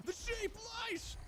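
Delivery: high, slow tattletale cry; high cartoon animal voice. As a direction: squeal out the accusation. Animal betrays the sheep.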